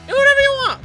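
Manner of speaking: very high, loud outburst; exasperated giving up. Real, not acted. Commentator gives up on the tag pairing, exasperated. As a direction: throw it away in a high, exasperated cry.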